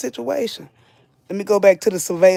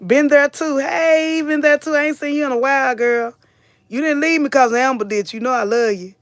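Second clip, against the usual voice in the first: high sing-song greeting; 7 semitones higher than usual. Acted. Greeting a returning viewer, playful.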